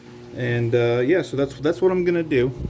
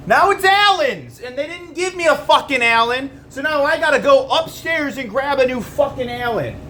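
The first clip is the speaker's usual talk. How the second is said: high, loud exasperated rant; angry rant; 11 semitones higher, 3 dB louder than usual. Real, not acted. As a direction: yell it in frustration. Ranting about missing Allen key.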